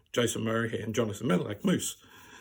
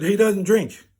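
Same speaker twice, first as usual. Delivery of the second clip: raised-voice retort on a friend's behalf; mock indignation; 7 semitones higher, 9 dB louder, slower than usual. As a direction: imitate a protective mate cutting in, loud and higher. Mate snaps at stranger, acted.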